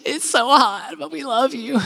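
high, soft, whimpering fake sob; mock crying. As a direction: pretend to weep through praise. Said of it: "sweating and crying and like".

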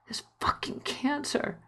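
slow, breathy whisper, choked up; barely voiced through tears; tearful whisper. Husband breaks down in tears.

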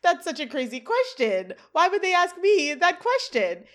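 high, loud, indignant outburst; screaming. Acted. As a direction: act out a panicked protest at full pitch. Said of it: "he screams".

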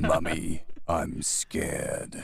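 low, slow mock plea to mummy; deep-voiced parody of a frightened kid; scared child role. Actor performing a child's line in an incongruously low voice, mock scared.

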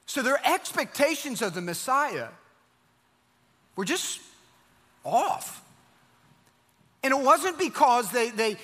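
preaching at full force. Acted. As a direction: drive the point home to the congregation.

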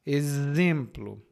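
"Exemplo" slow, X said as z; "Exemplo" drawn out with a buzzed z; z sound. Shown as a demonstration. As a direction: model "Exemplo" as e-ZEM-plo. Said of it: "O primeiro é Z".

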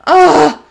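high, frustrated "ugh"; exasperated groan. Real, not acted. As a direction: let out a fed-up groan. Disgusted groan after the rant.

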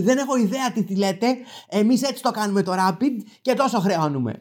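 high put-on clerk voice; imitated defensive clerk. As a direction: protest like a baffled assistant.

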